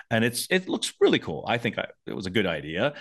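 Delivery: ordinary talk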